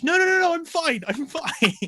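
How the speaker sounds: high, loud frantic protest; hammed-up frantic little girl; imitating panicky child. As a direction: squeal a hasty refusal as a kid. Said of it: "She goes".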